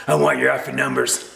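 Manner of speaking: mock angry voice of the canoe guy; imitating an irate canoeist; angry stranger. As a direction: bark it like a furious stranger.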